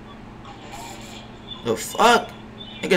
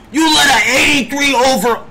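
angry shout; 7 semitones higher than usual. Real, not acted. Streamer raging at his teammate, angry shout. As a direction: yell it at him. Repeats the insult louder.

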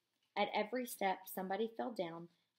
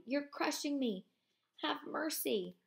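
high, pleading cry under the crush; desperate cry; 7 semitones higher than usual. Acted. Man crushed beneath the narrator, pleading in higher voice. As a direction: beg for mercy, higher voice. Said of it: "came a desperate cry".

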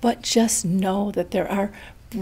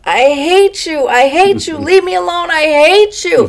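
higher, much louder, furious repeated screams; screaming grandma; 9 semitones higher, 13 dB louder than usual. Retold or imitated. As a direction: scream it out like a confused, angry old mother. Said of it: "I hear my mom scream out".

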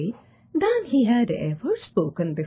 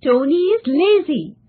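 high, louder stubborn insistence; pitched-up defensive protest; 7 semitones higher, 6 dB louder than usual. A narrator's reading. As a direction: insist on it, digging in. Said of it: "insisted John stubbornly".

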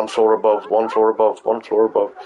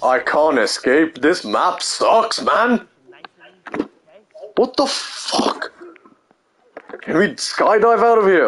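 high, drawn-out frustrated wail; slow, high-pitched cry of being stuck; exasperated despair; 10 semitones higher, slower than usual. Real, not acted. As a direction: whine in real frustration, pitch climbing. Trapped, frustrated with the map.

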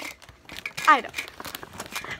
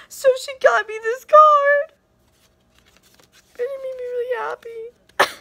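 touched squeal; 9 semitones higher, 5 dB louder than usual. Real, not acted. Moved by Disney card.

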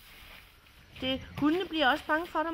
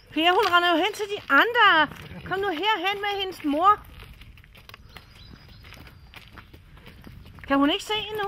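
loud, high, urgent calling; alarmed shout; 7 semitones higher, 7 dB louder than usual. Real, not acted. Wife calling urgently to Per as the dog runs off, loud and high.

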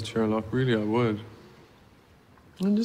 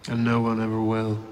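ordinary talk, nothing marked

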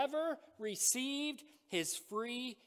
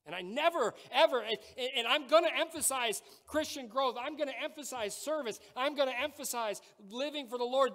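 louder, higher preaching, driving each point home; full-force preaching; 6 semitones higher, 6 dB louder than usual. Acted. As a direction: pastor pleading with the church about salvation, at full force.

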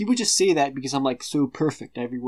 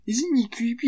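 mocking high gossip; 10 semitones higher than usual. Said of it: "bitches are like".

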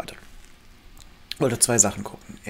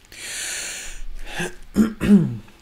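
throat clearing; 7 semitones higher, 6 dB louder than usual. Real, not acted.

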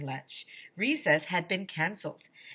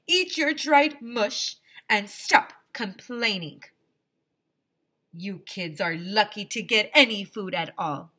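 stern Doctor Carbles; 3 semitones higher, 5 dB louder than usual. Acted. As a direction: scold the kids from above. Voices Doctor Carbles in story.

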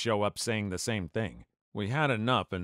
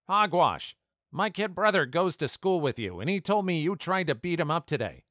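accusing bully; 8 semitones higher than usual. Retold or imitated. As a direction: throw the blame at him.

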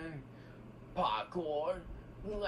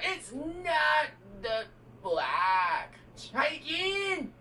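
game-show host call; 9 semitones higher than usual. Pretend. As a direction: shout it like a quiz host. Kid acts as a game-show host.

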